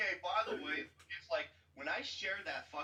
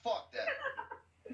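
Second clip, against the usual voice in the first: laughing. Real, not acted. Cracking up at the joke.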